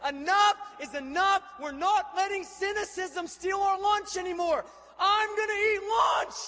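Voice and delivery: high, full-force defiant shout; roaring crowd cry. Retold or imitated. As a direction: roar it out like a rallying cry. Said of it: "ear-piercing roar as this group of two hundred fifty says".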